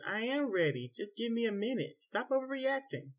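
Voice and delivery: high, exasperated retort; irritated. A narrator's reading. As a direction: brush off his anger, high and sharp. Wife snaps back in argument.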